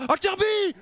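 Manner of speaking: high, loud cry of the name; commentator's cry as the shot flies; excited shout. Acted. Long shot called out.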